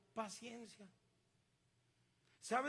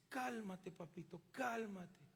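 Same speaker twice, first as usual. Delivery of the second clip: soothing parent; 6 dB quieter, slower than usual. Retold or imitated.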